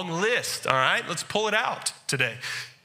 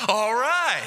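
pleased cashier; 5 semitones higher, 4 dB louder than usual. Retold or imitated. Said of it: "He got real happy".

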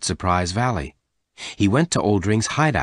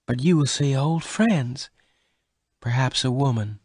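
a touch of sadness in a higher voice; sad; 6 semitones higher than usual. A narrator's reading. Bess fears Venters will meet another woman, sad. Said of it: "Bess said sadly".

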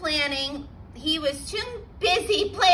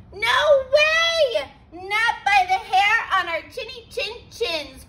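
high, loud shout of refusal; defiant cry; 6 semitones higher, 4 dB louder than usual. A narrator's reading. Pigs refusing the wolf, high loud shout. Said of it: "cried the three little pigs".

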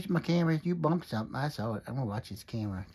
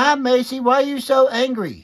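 loud greeting; 6 semitones higher, 8 dB louder than usual. Real, not acted. Grandpa loudly greets upset granddaughter.

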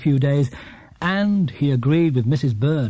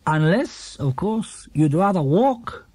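higher, lightly voiced storybook character; 6 semitones higher than usual. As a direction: speak as a story character, a bit raised. Character speaks to Paddington.

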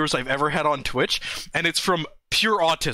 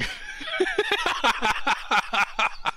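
high laughing fit. Real, not acted. Laughs at the perfect timing.

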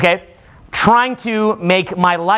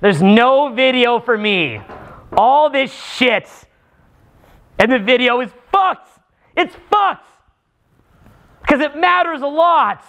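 higher-pitched mock fury over the ruined video; demo rant; 6 semitones higher than usual. Shown as a demonstration. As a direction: rant about the lost video in a raised, whining pitch. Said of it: "talking about weird shit that pisses you off how I did".

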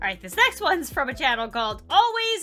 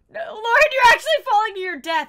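mock panic; 7 semitones higher, 8 dB louder, faster than usual. Mock narrating own fall.

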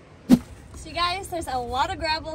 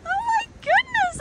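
much higher, loud gasp of disbelief; thrilled exclaim; 4 dB louder than usual. Real, not acted. Seeing Frank's huge jade, high amazed exclaim. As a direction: burst out in amazed delight.

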